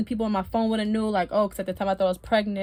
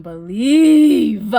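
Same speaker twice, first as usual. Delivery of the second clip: loud, drawn-out, incredulous; laughing disbelief; 3 semitones higher, 13 dB louder than usual. Real, not acted. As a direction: stretch it out loudly into a laugh. Incredulous recalling the raid.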